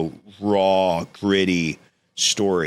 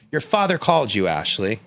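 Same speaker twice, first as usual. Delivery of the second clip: higher, pressing repeat of the news; raised, emphatic motherly insistence; insistent retold mom; 3 semitones higher than usual. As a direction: imitate a mother insisting, dropping on the name. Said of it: "She goes".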